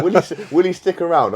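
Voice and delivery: laughing through a mock-sinister thought. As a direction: giggle while plotting the new guy's pain. Laughing, voicing his own old thought.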